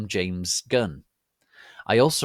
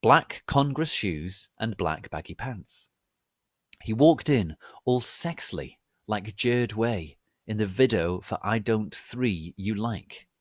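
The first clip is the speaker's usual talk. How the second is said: usual speaking voice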